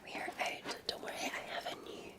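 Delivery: normal talking voice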